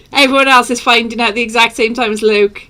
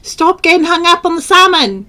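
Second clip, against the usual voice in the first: high, loud telling-off; mock exasperated scolding; 6 semitones higher, 4 dB louder than usual. Real, not acted. Host telling co-host off about salmon, mock exasperated. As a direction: scold them in exasperation, pitched up.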